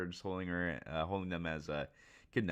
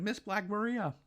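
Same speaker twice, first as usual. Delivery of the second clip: mock henchmen cry; 11 semitones higher than usual. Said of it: "Everyone's like".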